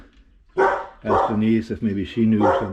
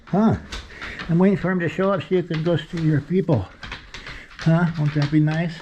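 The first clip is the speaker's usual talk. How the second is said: talking to pets; 8 semitones higher than usual. Real, not acted. Talking to the rescued dogs.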